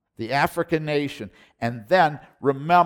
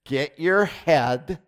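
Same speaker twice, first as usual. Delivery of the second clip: ordinary talk, nothing marked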